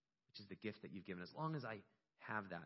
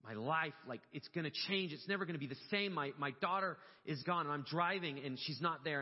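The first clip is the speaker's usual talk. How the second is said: raised, loud, emotional retelling; getting emotional; 5 semitones higher, 5 dB louder than usual. Real, not acted. Father recalling the drive when his daughter was missing, getting emotional. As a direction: relive the panic with real emotion rising in the voice. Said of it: "I'm getting emotional".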